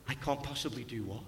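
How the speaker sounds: low, soft rhetorical question; quiet challenge. Acted. Jesus' answer, voiced by preacher.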